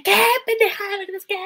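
high-pitched mock yelling; gritón imitado. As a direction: imitate someone screaming an angry challenge. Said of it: "cuando la gente grita". (imitando a alguien que grita)

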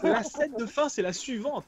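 normal talking voice

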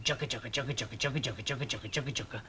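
low, soft rhythmic chug; soft low chugging noise; washing machine chugging. A narrator's reading. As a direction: imitate a machine churning quietly and evenly. Narrator imitates washing machine noise.

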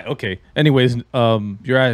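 ordinary talk

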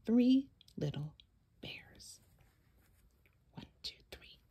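breathy hushed count; suspense whisper. A narrator's reading. Storyteller hushing the counting of bears. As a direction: whisper like a secret.

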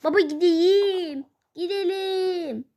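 put-on drawn-out childish begging voice. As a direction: beg like a small boy. Kid character begging father.

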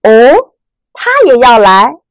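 哦 and 来 rising, slow surprised question; uptalk. Shown as a demonstration. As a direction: lift 他也要来 upward in doubt. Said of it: "再来一次". (疑问语气，声音往上扬)

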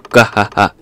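hammed-up "gah-hah-hah"; hearty mock guffaw. Shopkeeper to the boy about the new menu, mock guffaw. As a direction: act out a shopkeeper's belly laugh. Shopkeeper's laugh read out in character.

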